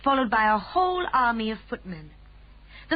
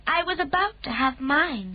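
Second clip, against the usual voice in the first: a lightly voiced calm Queen, higher; gracious royal command, a touch higher; 6 semitones higher than usual. A narrator's reading. Queen speaks.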